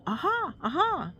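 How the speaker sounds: high, trying out a triumphant Aha; practicing aha. A narrator's reading. Pooh practicing his Aha, high and bold. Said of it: "said Pooh, practicing".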